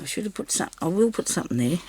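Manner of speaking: ordinary talk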